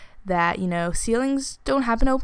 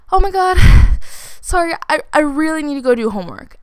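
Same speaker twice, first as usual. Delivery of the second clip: louder, higher, flustered blurting; panicked excuse; 7 semitones higher, 8 dB louder, faster than usual. Retold or imitated. Girl to boy leaning in to kiss, flustered loud excuse. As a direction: act out a hurried escape with a loud sigh.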